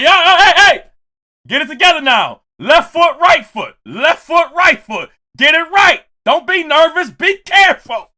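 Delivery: loud, high drill-style calling out; hollered coaching. Retold or imitated. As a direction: shout steps at a kid stumbling on stage. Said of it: "I'm like".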